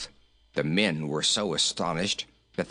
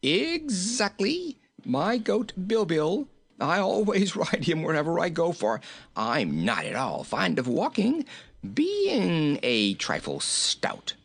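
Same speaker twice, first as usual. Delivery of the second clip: high merry voice, stretching words; tickled by his own joke, ending in high laughter; jolly, giggling king; 8 semitones higher than usual. A narrator's reading. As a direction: chuckle along as you boast.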